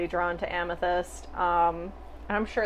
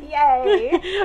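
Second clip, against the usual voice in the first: high, loud little "yay"; laughing, re-enacted delight at a gift; mock cheer; 8 semitones higher, 8 dB louder than usual. Acts out receiving a gift.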